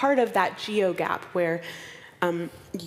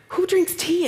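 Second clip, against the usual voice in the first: higher and louder, playfully baffled; mock outrage; 11 semitones higher, 5 dB louder than usual. Recalling her reaction to tea at dawn, mock scandalized. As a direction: act scandalized.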